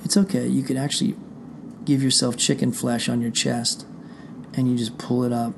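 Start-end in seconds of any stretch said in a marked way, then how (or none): none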